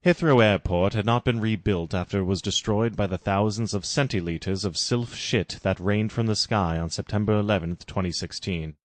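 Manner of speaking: plain reading voice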